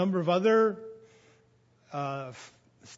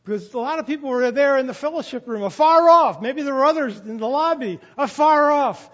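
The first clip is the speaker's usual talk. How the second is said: fast, rising, ever louder preaching; building loudness; 6 semitones higher, 10 dB louder than usual. Real, not acted. Preacher building up loudly before shouting the hymn number. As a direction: drive it up louder to the back rows.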